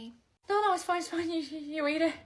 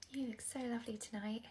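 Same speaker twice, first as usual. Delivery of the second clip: ordinary talk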